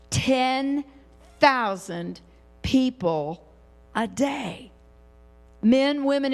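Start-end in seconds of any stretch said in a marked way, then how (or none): 0.1-4.7 "Ten... thousand... people... a day", a pause after each word; emphatic word-by-word. Real, not acted. Preacher driving a shocking number home to the congregation, pausing between the words of "Ten thousand people a day".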